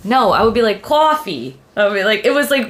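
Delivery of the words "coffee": put-on Boston accent. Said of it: "I was born with a Boston accent".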